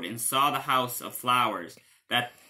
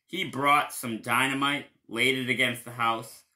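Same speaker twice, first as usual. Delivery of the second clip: ordinary delivery, nothing marked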